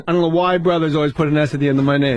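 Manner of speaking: ordinary talk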